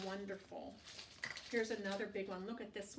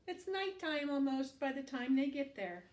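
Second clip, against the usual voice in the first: usual speaking voice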